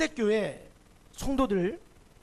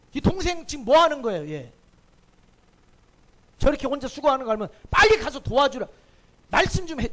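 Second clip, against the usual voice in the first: louder, pressing complaint; arguing with Jesus; 4 semitones higher, 6 dB louder than usual. Retold or imitated. Martha demanding Jesus rebuke Mary, loud and pressing. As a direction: insist he scold her, loudly. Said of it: "그러면서 예수님과 따지는 거예요".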